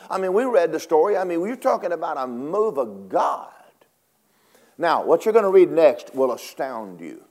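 normal talking voice

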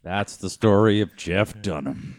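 low, slow storyteller's close; mock narrator sign-off. Co-host closing his pal's story, mock-solemn narrator. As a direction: intone it like closing credits.

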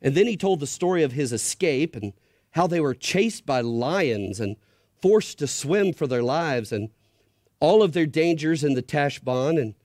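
reading a tense story aloud; story narration. A narrator's reading. Pastor reading Narnia to the church, hushed storytelling. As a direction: build suspense as you read to kids.

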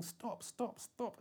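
high-pitched but held-back shouting; a lightly voiced frantic yell; mock shouting. Running between rooms, begging the assistants, high held-back shouts. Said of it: "flapping, shouting".

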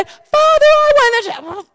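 high, loud imitated outcry; loud child's cry. Daughter calling out to God in prayer, imitated loud cry. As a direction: cry out like a little girl praying loudly. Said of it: "so loud".

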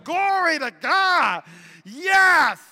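high, drawn-out mock-revival shout; jubilant shout. Husband rejoicing over leftover dessert, exultant shout. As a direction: belt it out in comic triumph.

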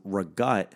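RAGUT with a G and no final vowel; dialect ricotta. Retold or imitated. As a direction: say "ragut" for ricotta, cut short after the T. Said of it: "tell someone that you would like".

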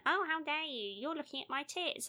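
high, mock indignant put-on voice; outraged passenger. Passenger accusing the ticket inspector, high mock outrage. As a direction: play the prissy offended passenger.

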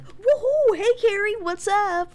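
high, loud whooping greeting; hammed-up overjoyed hello; mock enthusiasm. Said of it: "Is he supposed to be like".

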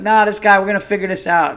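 acted loud protest; mock insistence. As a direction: blurt it out as an overconfident objection. Acting out confident people on plane.

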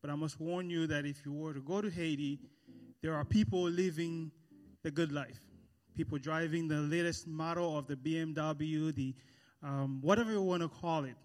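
ordinary talk, nothing marked

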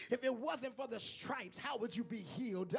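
full-force sermon holler; preaching at full force. Acted. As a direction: thunder it at the congregation.

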